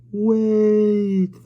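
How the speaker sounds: high, loud whining wind echo. A narrator's reading. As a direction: let the wind moan the word. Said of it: "the wind echoed, whining round him".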